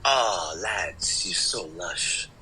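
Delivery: lightly put-on Geordie accent, slow and soft; a hint of a Newcastle lad saying lush. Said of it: "they say".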